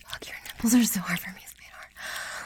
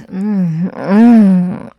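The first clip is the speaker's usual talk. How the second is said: ordinary delivery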